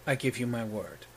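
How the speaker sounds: low, quiet solemn promise; a lightly voiced earnest oath; big guy's vow. Big guy promises Saoirse, low solemn vow. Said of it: "and he goes".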